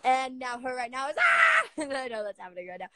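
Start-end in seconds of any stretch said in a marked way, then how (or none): 1.2-1.6 high-pitched squeal of excitement; excited squeal. Real, not acted. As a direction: squeal with delight. Excited squeal while live.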